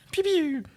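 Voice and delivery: high playful pew pew; firing noises. Pretend. Erin plays with her toy ship, making firing noises. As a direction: mimic laser shots like a kid. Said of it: "began making firing noises".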